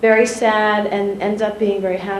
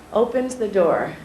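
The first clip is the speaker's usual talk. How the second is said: ordinary delivery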